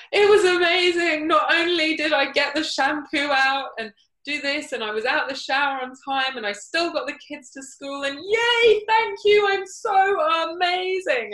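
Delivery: high, loud, gushing mock acceptance speech; over-the-top Oscar speech. To her small group, a hammed-up Oscar acceptance speech. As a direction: ham it up like an Oscar winner thanking everyone. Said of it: "in the style of an Oscar acceptance speech, but, like, really over the top".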